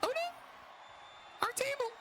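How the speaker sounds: high-pitched panicked cries; shocked squeals. Real, not acted. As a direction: wail at the broken table. Streamer reacts to broken table.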